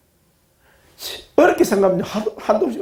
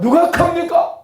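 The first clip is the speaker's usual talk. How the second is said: call and response lead; 12 semitones higher than usual. Shown as a demonstration. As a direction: lead the line so they repeat it. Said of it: "한번 따라합시다".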